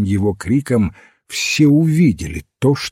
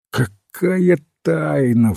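slow, higher indignant-style exclamation; drawn-out exclamation at raised pitch; exclaiming; 5 semitones higher, slower than usual. A narrator's reading. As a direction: cry it out, stretching the words. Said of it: "вскричал Хантер".

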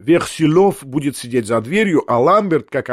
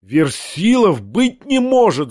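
higher, slow outcry; disbelieving wail; 5 semitones higher, 3 dB louder, slower than usual. A narrator's reading. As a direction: cry out against it, higher and drawn out. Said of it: "завопил я".

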